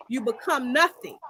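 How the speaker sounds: ordinary talk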